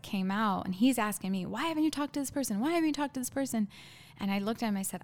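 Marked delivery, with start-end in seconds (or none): 1.5-3.7 higher, fast mock interrogation; imitated badgering. Imitating a man pressing her with the same question, badgering. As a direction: rattle off the repeated demand at a raised pitch.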